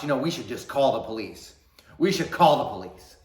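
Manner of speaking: building to a loud, high shout; mom's threat. Retold or imitated. Mom threatening police.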